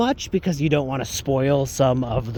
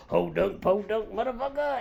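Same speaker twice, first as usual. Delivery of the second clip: hint of a wild movie villain, rising high; Billy Zane; 5 semitones higher than usual. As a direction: lightly imitate a rowdy horror villain. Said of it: "he really reminded me of Billy Zane".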